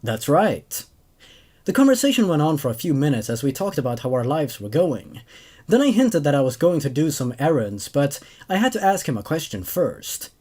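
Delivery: even first-person narration; story narration. A narrator's reading. Narrator telling of returning Marine, in even narration. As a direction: read it like a calm soldier's recollection. Reads second story aloud.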